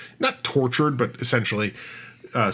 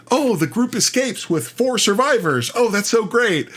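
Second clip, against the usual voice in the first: higher, bright sarcastic cheer; mock upbeat recap; 8 semitones higher than usual. GM recapping the escape before the twist, higher mock-cheerful tone. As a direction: sell the happy ending you know is fake.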